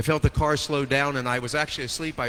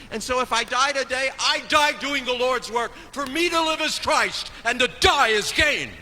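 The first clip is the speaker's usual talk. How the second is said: high, ringing preacher's cry, rising with joy; triumphant sermon peak, voice lifted high; preaching at full force; 12 semitones higher, 3 dB louder than usual. Acted. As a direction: proclaim it like a revival preacher at full pitch. Impassioned preaching climax.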